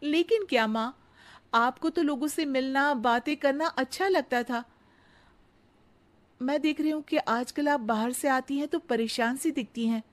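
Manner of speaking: sweet daughter-in-law. Acted. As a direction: flatter the mother-in-law sweetly. Radha presses.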